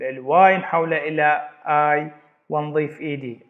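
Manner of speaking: ordinary talk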